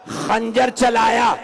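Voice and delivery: slow, loud, high wail; anguished cry. Acted. Preacher crying out the moment of the killing. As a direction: stretch it out in a cry of grief. Climax of the martyrdom narration.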